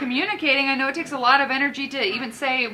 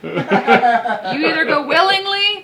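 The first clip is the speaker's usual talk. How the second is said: louder, laughing mock warning; playful threat; 6 dB louder, slower than usual. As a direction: tease the spirits with a threat. Mock threatens spirits with cleansing kit.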